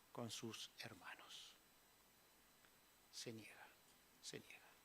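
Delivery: slow whisper fading out. Real, not acted. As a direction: let each se niega sink into a whisper. Preacher fades to a whisper.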